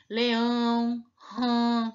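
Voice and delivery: Leão, rã with strong nasal ão, ã; nasal vowel demo. Shown as a demonstration. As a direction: hum the ending through the nose. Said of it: "Tá vendo como que sai?".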